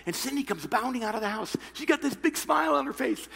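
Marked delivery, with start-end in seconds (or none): none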